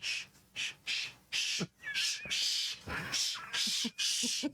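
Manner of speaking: sharp, hissed shushing back and forth; little creatures hushing each other crossly; angry shushing. Acted. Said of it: "they start shushing each other".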